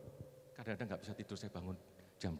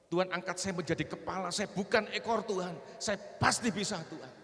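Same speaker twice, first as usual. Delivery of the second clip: raised, pleading declaration to God; retold insistent prayer; 8 semitones higher, 7 dB louder than usual. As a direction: re-enact praying with rising insistence. (menirukan doa memohon dengan nada tinggi)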